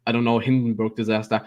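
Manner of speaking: ordinary talk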